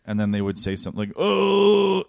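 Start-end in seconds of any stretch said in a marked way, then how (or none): 1.2-2.0 high, drawn-out customer oh; mock customer oh. Mocking the drive-thru customer's oh.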